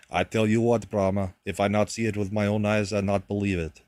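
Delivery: a hint of a Hungarian uncle's accent. Nephew quoting his Hungarian uncle in a light Hungarian accent. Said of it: "really thick accent".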